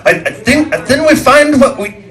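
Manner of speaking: put-on high Middle Eastern accent, eager. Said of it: "he says".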